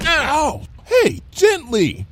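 high, drawn-out pained protest; yelp, then complain slowly; indignant high-pitched whine. A narrator's reading. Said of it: "Lyra complained".

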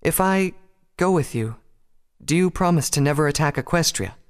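put-on high, hesitant girlish voice; high, tentative bargaining; nervous princess. A narrator's reading. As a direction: offer yourself timidly, with pauses. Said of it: "She swallowed nervously and stepped forward".

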